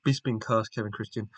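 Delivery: normal talking voice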